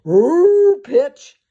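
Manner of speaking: high, squealing eager puppy; excited dog voice. Pretend. Dog's eager voice asking to pitch.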